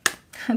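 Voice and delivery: quick nervous giggle; a short real nervous laugh; neurotic giggle. Real, not acted. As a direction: giggle like a jittery Gemini. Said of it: "Gemini neurotic giggle".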